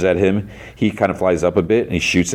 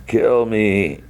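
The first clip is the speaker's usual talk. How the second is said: drawn-out, slightly raised groaning plea; distorted voice; 4 semitones higher than usual. Retold or imitated. Severed head begs Lucy, distorted drawn-out voice. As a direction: imitate a distorted, barely living head. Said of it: "in a distorted voice".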